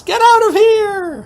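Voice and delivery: slow, shrill mock horror scream. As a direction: scream it at the ghost like a soap heroine. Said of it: "and Courtney screams".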